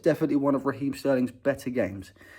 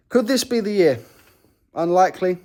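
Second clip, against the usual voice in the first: hopeful excitement; 9 semitones higher, 9 dB louder than usual. Real, not acted. Hopeful trophy question.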